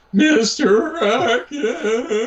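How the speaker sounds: put-on high, drawn-out teenage whine; pimply teen. Imagined bowling alley teen mocking a customer, high pimply-teen voice. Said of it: "some pimply faced teen".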